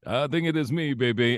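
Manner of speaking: high, hyped DJ character voice. Retold or imitated. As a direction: slip into the radio DJ persona. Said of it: "bust into the voice".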